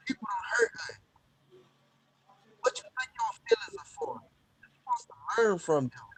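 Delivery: muffled, hand over the mic; muffled, like through a wall. Real, not acted. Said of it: "You sound muffled".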